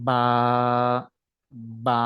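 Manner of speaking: বা with both lips pressed, then released; বা, lips closed and released; Arabic ba demo. Shown as a demonstration. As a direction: press the lips together and pop out বা. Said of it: "লাগিয়ে সেটাকে আমরা এভাবে ছেড়ে দিব".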